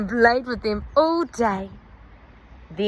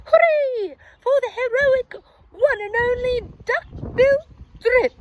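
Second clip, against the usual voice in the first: very high, loud joyful cheer; storybook victory chant, very high; cheering hoot; 13 semitones higher, 5 dB louder than usual. A narrator's reading. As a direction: cheer for the hero. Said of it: "They hooted".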